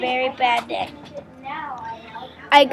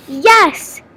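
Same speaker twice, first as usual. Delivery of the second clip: short, higher, louder cheer; excited yell; 4 semitones higher, 5 dB louder than usual. A narrator's reading. Kids answering mom's brownie offer, yelling. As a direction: read out an eager shout. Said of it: "all yell".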